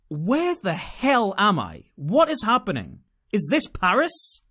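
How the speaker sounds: exasperated tourist's inner cry; bewildered. Retold or imitated. Bewildered thought acted out.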